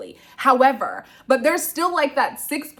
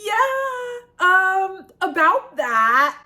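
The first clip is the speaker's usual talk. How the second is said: awkward; 10 semitones higher, 5 dB louder, much slower than usual. Retold or imitated. Awkward admission to cheering group.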